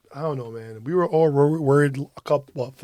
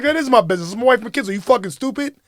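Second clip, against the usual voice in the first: higher and louder, indignant; angry shout; 8 semitones higher, 5 dB louder, much faster than usual. Retold or imitated. Husband defending wife and kids to stranger, angry shout. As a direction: throw it at her hard. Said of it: "I'm like".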